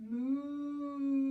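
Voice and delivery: put-on a playful cow moo for the kids. Teacher leading kids' yoga moos like a cow, playful. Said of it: "let's all moo like a cow".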